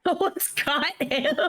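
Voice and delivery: real laughter through slow words; laughing. Real, not acted. Repeats it while laughing.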